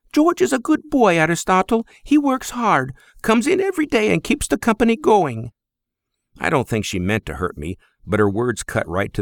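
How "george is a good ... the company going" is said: put-on high, accented mother's voice; Greek mother. Greek mother defends George to her son in a high voice. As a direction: scold gently as a proud Greek mother.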